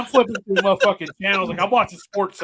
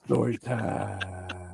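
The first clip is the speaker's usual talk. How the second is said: much lower, very slow, drawn-out title; deep announcer voice; 8 semitones lower, 7 dB quieter, much slower than usual. Pretend. Echoing the show name in a much lower, very slow trailer voice. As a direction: intone it like a movie trailer.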